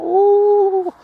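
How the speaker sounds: very high, loud held ghostly ooh; spooky ooh. Pretend. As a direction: howl a spooky ooh at the joke. Spooky reaction to werewolf joke.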